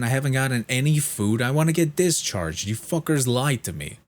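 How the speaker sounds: high, loud re-enacted outburst; angry yelling. Hungry patient demanding breakfast and discharge from nurse, acted-out yelling. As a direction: act out a furious patient's tirade. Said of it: "What are you yelling about?".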